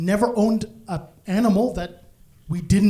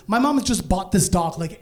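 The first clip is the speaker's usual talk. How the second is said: ordinary delivery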